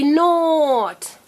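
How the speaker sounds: "not" with a long vowel; said slowly. Shown as a demonstration. Teacher modelling slow before fast, drawn out. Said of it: "Now say it fast".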